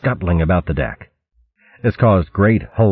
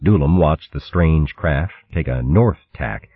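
ordinary delivery, nothing marked

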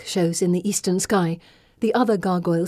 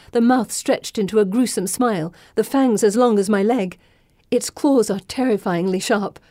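ordinary delivery

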